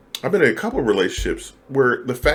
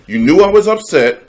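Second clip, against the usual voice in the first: higher and louder, accusing; hurt partner raising her voice; upset partner; 10 semitones higher, 10 dB louder than usual. Acted. Acting out an upset partner scolding him, high and loud.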